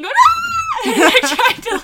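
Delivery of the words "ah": very high, loud mock scream; imitated screaming. Teller chasing moth with zapper, mock scream. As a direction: re-enact a running, panicked scream. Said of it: "running around the house screaming".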